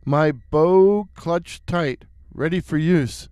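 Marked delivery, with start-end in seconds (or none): none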